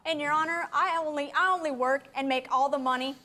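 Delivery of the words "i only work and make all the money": ordinary talk, nothing marked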